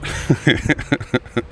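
light, airy, rapid laugh; real chuckle. Real, not acted. Comic recalls Joey riffing on it, airy chuckle. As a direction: chuckle in a quick airy run. Laughs about being riffed on.

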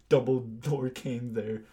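ordinary talk, nothing marked

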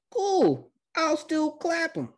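very high, put-on cocky squeal; hammed-up falsetto bravado; cocky character voice. Recapper as Sasuke shrugging off a trap, mock cocky.

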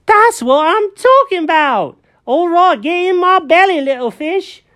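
greedy kitty Jenkins. Acted. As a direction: gloat like a cat spotting dinner. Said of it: "And Jenkins goes".

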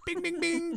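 high chiming bell noises; ding like a game show bell; bell sound effect. Shown as a demonstration. Scoring joke sound.

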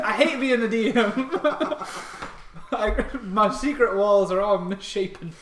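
high loud laughter through words; laughing. Real, not acted. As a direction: crack up while talking. DM laughs at own mistake.

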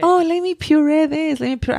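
a hint of a high fussy new mom; mock the anxious new mother; fussy first-time mom. Mom mocking her past self fussing over baby food.

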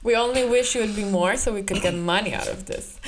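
ordinary talk

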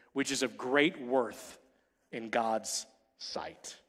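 gentle slow close to the verse; slow soft scripture. Real, not acted. Preacher closes the verse to the women gently. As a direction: let the verse settle slowly.

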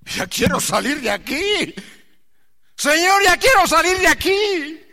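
high, loud cry of someone trapped; anguished plea. Acted. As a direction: beg to be let out, rising to a cry. Voicing someone trapped in agony. (súplica angustiada y fuerte)

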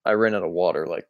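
low, spent, voice fading out; really worn out, energy draining away; very tired. Real, not acted. Explaining to co-host he ran out of water, very tired. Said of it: "You sound very tired".

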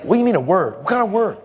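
high, incredulous imitation; suspicious bodybuilder. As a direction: question it sceptically, pitch climbing. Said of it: "He's like".

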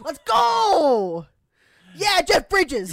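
loud high victory shout; excited cheer. Real, not acted. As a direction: cheer like you just won. Cheering a guessed answer.

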